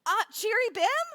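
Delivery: acted searching shout with a question; hopeful calling out. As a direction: call out unsure, lifting at the end. Rabbi calls out to a group.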